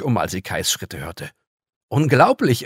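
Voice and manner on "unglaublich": a loud, higher outraged cry. Acted. Girl outraged at the visitor, loud. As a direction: burst out in disbelief. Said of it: "empörte sie sich".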